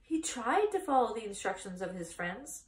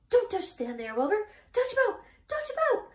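high, loud honking cry rising higher; a goose shrieking orders at the pig; frantic goose; 8 dB louder than usual. A narrator's reading. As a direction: goose yelling at Wilbur to dodge the chasers. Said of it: "cried the goose".

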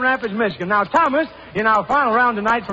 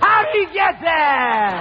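amazed; 6 semitones higher, slower than usual. Real, not acted. Quizmaster amazed the soldier got the answer.

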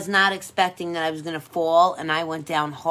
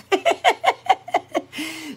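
laugh; 9 semitones higher than usual. Real, not acted. Laughs at own suggestive bit.

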